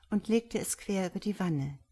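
plain reading voice

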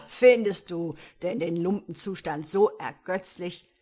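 lightly voiced laughing devil. A narrator's reading. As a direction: chuckle at the sprite. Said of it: "fragte lachend der Teufel".

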